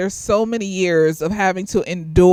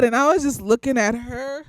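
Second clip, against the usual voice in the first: ordinary talk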